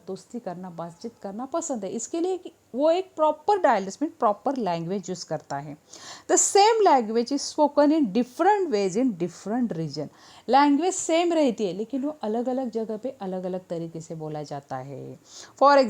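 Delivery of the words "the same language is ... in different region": slow, deliberate textbook reading; slow read-aloud. Acted. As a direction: spell it out slowly for students.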